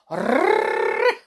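"Rrrr" as a long high rolled trill. Shown as a demonstration. Narrator showing the witch's R, long rolled "Rrrr". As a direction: roll "Rrrr" round the mouth like hot crackling. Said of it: "She would roll it around her mouth".

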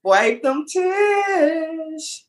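very high, long-drawn coo; playful, exaggerated soothing; teasing comfort. Real, not acted. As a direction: coo it high, stretching the last word right out. Teasing comfort for crying friend.

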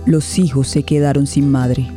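normal talking voice

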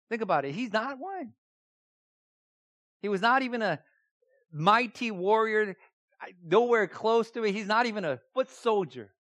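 normal talking voice

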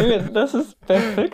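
high laughing insistence. Real, not acted. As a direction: laugh while defending it. Laughing defence of his singing.

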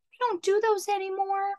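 high-pitched imitation of a worker; drive-thru girl. Drive-thru girl refusing a customer, high mock voice. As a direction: play the worker with a high put-on voice. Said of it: "the girl goes".

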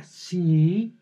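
a doubtful yes with a catch; yes, but with a condition hanging on it; hesitant yes. Shown as a demonstration. Girlfriend answering a proposal, a grudging conditional yes. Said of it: "ah, caray, ¿qué es la condición?".

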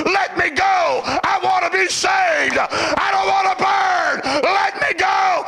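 high, drawn-out desperate shout; acted screams of pleading; screaming sinners. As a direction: cry out as the damned begging for release. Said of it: "able to hear them scream".

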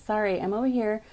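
ordinary delivery

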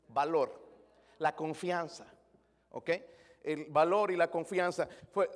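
ordinary delivery

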